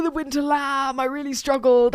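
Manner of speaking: high, teary and breaking; choked up. Real, not acted. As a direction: say the name as if about to cry. Overwhelmed recalling sad film character.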